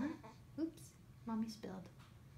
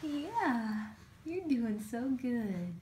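excited praise; 2 semitones higher, 7 dB louder, much slower than usual. Real, not acted. Praising baby eating.

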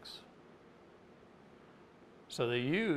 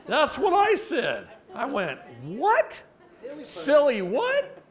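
high, loud, slow baffled outcry; hammed-up puzzled what; mock confusion; 13 semitones higher, 10 dB louder, slower than usual. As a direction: act totally baffled by the word. Retelling confused reaction to word.

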